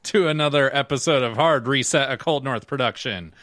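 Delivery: upbeat, loud show intro; podcast host intro. Acted. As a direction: welcome the listeners like an announcer. Opens the podcast episode.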